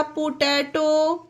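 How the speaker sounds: पोटैटो said slowly, each p sounded as a clear pa; drawn-out teacher demo of पोटैटो, both p's spoken plainly; slow model word. Shown as a demonstration. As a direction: carefully sound out पोटैटो at half speed. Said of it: "पी को पॉ से pronounce करा".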